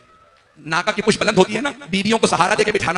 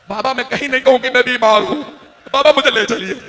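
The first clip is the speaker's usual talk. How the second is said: put-on raised, pleading cry of a sick girl; pleading child; 5 semitones higher, 4 dB louder than usual. Daughter begging her father to take her along, pleading. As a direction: beg her father not to leave her behind.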